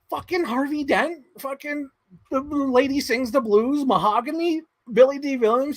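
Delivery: high, stunned list of roles; disbelief. Retold or imitated. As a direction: rattle them off high in disbelief. Listing his roles in disbelief.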